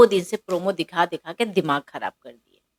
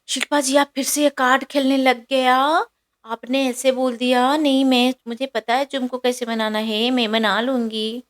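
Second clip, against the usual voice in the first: mimicking a whiny protest; 6 semitones higher than usual. Shrutika snaps back at Shilpa.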